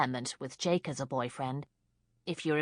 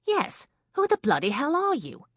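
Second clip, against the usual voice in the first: high, loud indignant woman's demand; a woman snapping at an intruder; 11 semitones higher, 5 dB louder than usual. A narrator's reading. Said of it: "Kate demanded".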